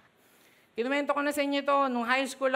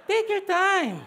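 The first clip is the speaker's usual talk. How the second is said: laughing; 8 semitones higher, 4 dB louder, slower than usual. Real, not acted. Pastor laughs at the joke.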